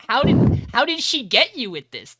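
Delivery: loud, high incredulous retelling; hammed-up delighted disbelief; amused disbelief. Acted reply while busting up laughing.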